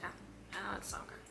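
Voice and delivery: soft, deflated groan of letdown; disappointed sigh. Retold or imitated. Learning the new cover comes out later, quietly deflated. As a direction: sag into a quiet disappointed moan. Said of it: "so I was like".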